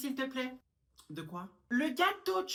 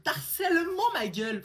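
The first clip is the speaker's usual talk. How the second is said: breaks out laughing, higher and louder; laughing outburst; 9 semitones higher, 8 dB louder than usual. Acted. Student laughing at her own accusation in front of the teacher. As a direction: crack up mid-sentence.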